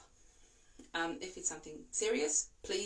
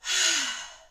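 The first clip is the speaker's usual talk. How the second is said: loud demo exhale, breath pushed out high; long breath out; 10 semitones higher, 8 dB louder than usual. Shown as a demonstration. Coach shows a patient how to breathe out, loud sigh. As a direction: let a big sigh go to show the release. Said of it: "So breathe in".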